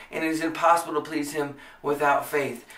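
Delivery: ordinary talk, nothing marked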